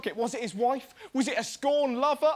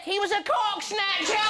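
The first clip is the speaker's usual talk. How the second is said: hammed-up detective reveal; 6 semitones higher than usual. Mock Miss Marple verdict.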